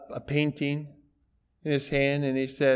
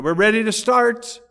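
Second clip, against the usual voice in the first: loud, higher announcing voice; auctioneer announcing; 7 semitones higher, 6 dB louder than usual. Retold or imitated. Auctioneer opening the sale to the crowd, loud announcing. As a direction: call the room to order like an auctioneer.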